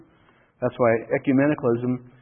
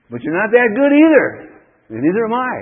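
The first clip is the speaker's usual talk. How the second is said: loud, high preaching retort; emphatic rebuttal; 8 semitones higher, 8 dB louder than usual. Real, not acted. Preacher answers the congregation's excuse, emphatic shout. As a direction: raise the voice and drive it home.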